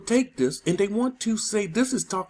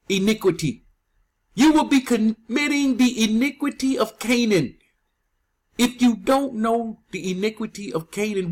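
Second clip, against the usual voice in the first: ordinary delivery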